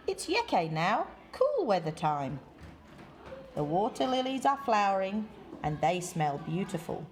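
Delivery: ordinary delivery, nothing marked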